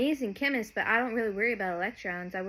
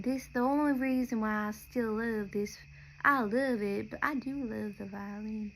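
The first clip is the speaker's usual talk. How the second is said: normal talking voice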